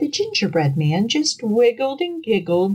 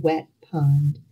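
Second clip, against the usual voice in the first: usual speaking voice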